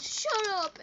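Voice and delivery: high mock snap; imitated whiny retort; annoyed outburst. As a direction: cut him off indignantly. Boy snaps back in retelling.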